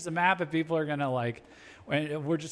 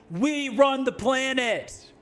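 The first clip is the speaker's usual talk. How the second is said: mock brash American, higher and slow; 9 semitones higher, 3 dB louder, slower than usual. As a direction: ham up a boastful American superpower.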